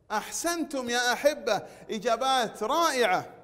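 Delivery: normal talking voice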